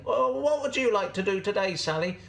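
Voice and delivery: high, friendly goat voice; Gladys the goat. Acted. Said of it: "Gladys would say".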